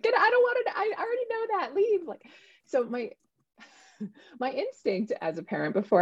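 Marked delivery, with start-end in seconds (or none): 0.0-2.1 much higher, mock shrieking kid; mock child screaming at her mom; mimicked child's scream. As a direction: squeal it like a cranky seven-year-old. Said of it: "she screamed at me".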